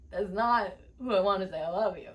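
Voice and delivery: put-on weepy voice; mock tearful. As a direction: whimper it as if still crying. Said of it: "I'm crying".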